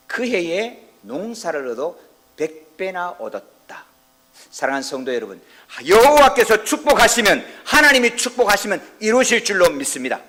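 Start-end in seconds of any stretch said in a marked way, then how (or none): 0.1-3.8 slow scripture reading. Acted. Pastor to congregation, slow solemn verse reading. 5.9-10.2 preaching at full force. Acted. Pastor to congregation, loud high preaching shout.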